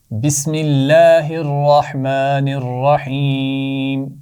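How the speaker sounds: slow, drawn-out prayer recitation. Real, not acted. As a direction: chant it slowly and reverently. Teacher recites opening prayer. (آہستہ تلاوت کے انداز میں)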